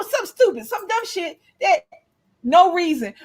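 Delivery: normal talking voice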